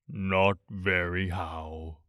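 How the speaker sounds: low, slow, mournful donkey voice; gloomy Eeyore. A narrator's reading. Narrator voicing Eeyore to Pooh, low and glum. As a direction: drag it out like a sad old donkey.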